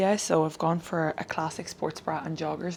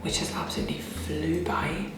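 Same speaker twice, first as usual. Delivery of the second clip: whispering. Real, not acted. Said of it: "I'm having to whisper this morning".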